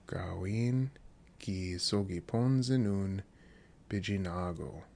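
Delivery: slow "Gawin ki sogi ponze nun bijinago" with pauses between chunks; soft, drawn-out phrase, chunk by chunk; slow, broken-up repetition. Shown as a demonstration. Teacher repeating a quiz phrase for learners, slow and broken up.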